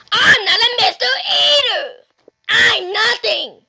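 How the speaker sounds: high, loud play-acted outburst; indignant correction. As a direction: object hotly to the wrong title. Indignant at second wrong title.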